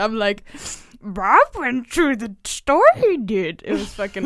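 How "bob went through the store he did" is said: slow, louder Yoda imitation. Tutor reading an SAT question to teens in a Yoda voice. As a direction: drag out each word like Yoda. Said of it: "read a question in a Yoda accent".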